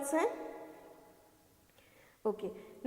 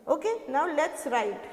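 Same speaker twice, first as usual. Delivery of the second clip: ordinary delivery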